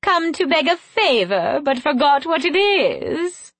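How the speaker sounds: high, taunting queenly voice; cruel mockery. A narrator's reading. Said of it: "her voice cruel".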